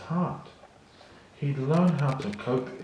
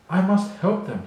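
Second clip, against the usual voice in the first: high, louder urgent cry; cried out; 8 semitones higher, 5 dB louder than usual. Acted. A young lion resolving to save the herd, crying out. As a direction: call out to spur himself on. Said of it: "he cried".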